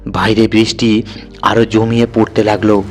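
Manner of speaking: plain reading voice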